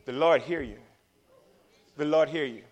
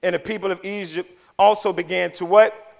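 ordinary delivery

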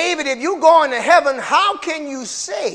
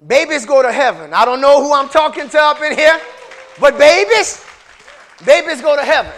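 louder, higher preaching cry; fiery preaching; 3 semitones higher, 7 dB louder than usual. Acted. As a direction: hammer the point home to the congregation. Preaching at full force.

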